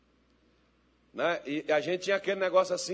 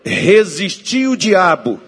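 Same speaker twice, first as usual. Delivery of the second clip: loud, slow phrases with pauses between; fervent preacher's prayer, building to a shout; full-force prayer; 14 dB louder, slower than usual. Real, not acted. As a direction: lead the congregation line by line, forcefully. Leads congregation in prayer.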